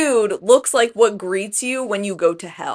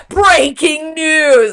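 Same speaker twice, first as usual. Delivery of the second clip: louder, higher, drawn-out announcement; mock news announcer; 7 semitones higher, 6 dB louder, much slower than usual. Co-host cutting in with fake big news, mock announcer. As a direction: stretch it out like a big bulletin.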